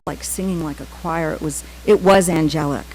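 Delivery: ordinary talk, nothing marked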